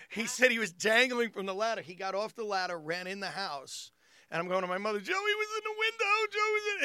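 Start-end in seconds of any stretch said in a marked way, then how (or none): 5.0-6.8 put-on high-pitched frantic child tattling; hammed-up frightened wail; mock panic. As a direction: blurt it to Mom in a high panicked cry. Frightened child telling mother, acted.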